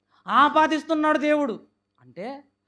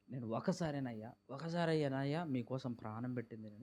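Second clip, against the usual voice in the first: quoting Jesus softly; 10 semitones lower, 15 dB quieter than usual. Retold or imitated. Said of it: "యేసుక్రీస్తు వారు చెప్తున్నారు".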